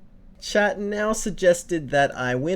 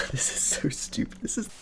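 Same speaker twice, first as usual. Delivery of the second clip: whispered giggle; 3 semitones higher, 8 dB quieter than usual. Real, not acted. Laughing at own silly intro.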